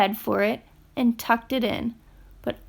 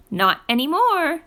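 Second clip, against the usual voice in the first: snuffed; 5 semitones higher, 4 dB louder than usual. Acted. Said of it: "snuffed the porcupine".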